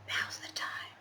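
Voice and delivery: whispered, suspenseful and hushed; mock-dramatic hushed whisper. Shown as a demonstration. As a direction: breathe it like a movie trailer teaser. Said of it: "I need some dramatic music".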